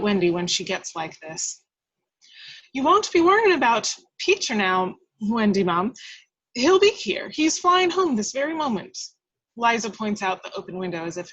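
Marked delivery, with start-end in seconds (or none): 2.7-9.0 higher and louder housekeeper, insisting; eager reassurance. Acted. Housekeeper reassuring Wendy that Peter is coming, eager. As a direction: promise her brightly he's coming.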